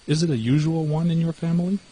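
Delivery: surprised. Acted. Mouse questions, astonished.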